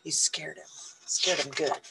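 ordinary delivery

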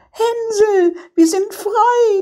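put-on very high, jubilant girl's cry; joyful calling out. A narrator's reading. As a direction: call out overjoyed to a freed brother. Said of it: "öffnete den Stall und rief".

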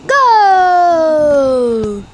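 loud goal yell; explosive goal commentary. Real, not acted. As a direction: shout the goal. Goal scored in game.

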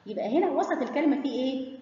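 ordinary talk

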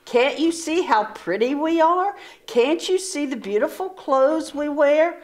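high, vain pleading; haughty boast. Acted. Proud sisters boasting to the sister, haughty. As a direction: show off your fine clothes.

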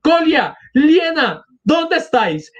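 put-on high-pitched call to lost children; acted mother's frantic calling; alarmed cry. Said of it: "Exclamó de pronto alarmada".

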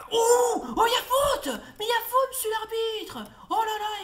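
very high, loud protest at the referee; furious cry at the ref; outraged shout. Real, not acted. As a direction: yell that it was a foul, indignant. Shouting at referee over foul.

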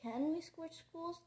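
high, slow hopeful question; eager schoolgirl asking a favour; bright hope. A narrator's reading. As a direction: ask it brightly, lifting the pitch. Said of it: "Sophie looked up brightly".

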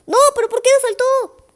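high, loud, exasperated yell; frustrated shout. Real, not acted. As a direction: shout at the game in disbelief. Character fails to jump.

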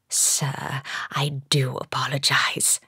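low, subdued apology; apologetic Eddie. A narrator's reading. Shopkeeper apologizing to a gentleman customer, apologetic.

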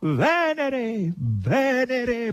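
loud, high and victorious; victorious cry; triumphant proclamation. A narrator's reading. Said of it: "proclamò vittoriosamente Natascia".

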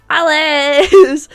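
"Alice" shouted as a shout-out; loud name call. Real, not acted. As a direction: announce "Alice" loud and proud. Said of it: "So say Alice".

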